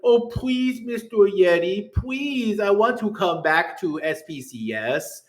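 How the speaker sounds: high, mocking whine of a banned player; whiny begging. Mocking a banned player begging Mister Yeti to come back, high whine. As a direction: plead in a pathetic high whine, mocking them. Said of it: "they go and they're like".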